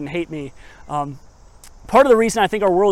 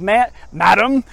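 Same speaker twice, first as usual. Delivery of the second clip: archaic polite plea, a bit higher and louder; hammed-up archaic preacher voice; old-fashioned pastor; 3 semitones higher, 6 dB louder than usual. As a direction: play the genteel old-time parson asking why. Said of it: "That's probably how he spoke".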